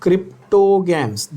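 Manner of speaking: stretched out, word by word, for notes; slow dictation. Shown as a demonstration. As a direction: dictate each word slowly so the class can write. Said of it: "ध्यान से नाम सुनना, repeat करना।".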